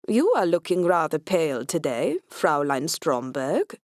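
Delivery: put-on Scandinavian accent. A narrator's reading. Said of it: "his accent is Scandinavian".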